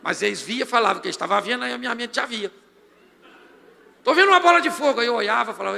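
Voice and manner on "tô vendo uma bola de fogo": high, loud claim of a vision; excited vision claim. Retold or imitated. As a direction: announce the fireball in rapture. Fingindo visão na oração. (anúncio exaltado, agudo e forte de visão)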